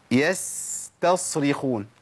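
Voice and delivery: يستصرخون very slow, clear س then heavy ص; يستصرخون each letter separated; slow careful model. Shown as a demonstration. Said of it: "ده جالي سين وصاد". (يستصرخون ببطء مع فصل المخرج)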